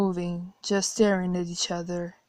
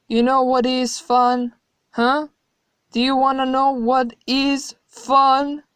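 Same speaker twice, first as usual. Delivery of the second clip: rising, louder, gleeful goading; growing taunt; 3 semitones higher, 6 dB louder than usual. Acted. God taunting the horrified narrator, building louder and gleeful. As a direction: build up to a menacing tease. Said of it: "His voice was growing".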